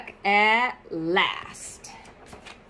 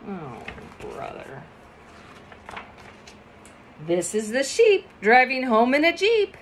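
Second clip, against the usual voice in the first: ordinary delivery, nothing marked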